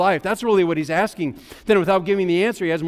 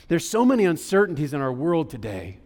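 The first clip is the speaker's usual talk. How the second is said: usual speaking voice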